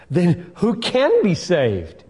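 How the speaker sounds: high, slow astonished cry; astonished disciples. Acted. Preacher voicing the astonished disciples. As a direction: gasp out the disciples' disbelief.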